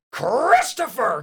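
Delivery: high, drawn-out name call; scolding mom. Retold or imitated. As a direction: holler a child's full name. Mom yelling at the wrong son.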